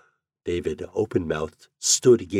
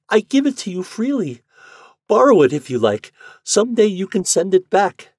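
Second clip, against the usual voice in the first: put-on much higher, louder begging girl; pleading Yuli; 13 semitones higher, 7 dB louder than usual. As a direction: beg him to accept.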